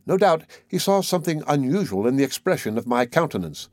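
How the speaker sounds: usual speaking voice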